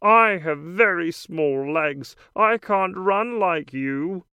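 lightly voiced frog character; a hint of a croaky frog. A narrator's reading. Frog continues.

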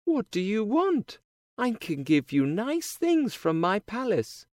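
put-on high, bright girlish princess voice; cartoon princess. A narrator's reading. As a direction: play a sweet young princess. Princess answers the frog.

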